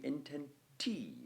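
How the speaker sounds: "intenti" with a long stressed "ti"; inten-TI, the last syllable held; stress on final TI. Shown as a demonstration. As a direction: land hard on the "ti" of "intenti". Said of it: "You're putting the stress".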